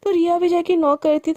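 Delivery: usual speaking voice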